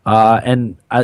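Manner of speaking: usual speaking voice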